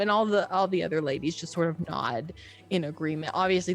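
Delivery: ordinary talk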